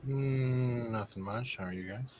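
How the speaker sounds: sick voice. Real, not acted. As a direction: talk like you're sick and half asleep. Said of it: "That's why I sound like that".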